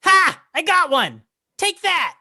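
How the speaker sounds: very high, loud kobold battle cry; triumphant shout. Acted. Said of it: "it just shouts back at you".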